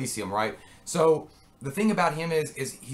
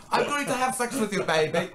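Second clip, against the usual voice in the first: Kruger; 9 semitones higher than usual. Retold or imitated. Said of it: "That's how it was".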